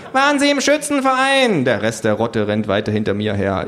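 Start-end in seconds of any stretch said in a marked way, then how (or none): 0.1-1.6 very high, loud questioning voice; shrill voice from a survey mob; badgering pollster. A narrator's reading.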